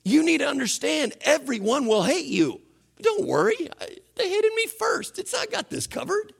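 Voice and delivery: high, pleading, near-crying voice; high, tender near-tears reassurance; Jesus speaking. Retold or imitated. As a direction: reassure them, voice cracking up high. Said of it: "he said".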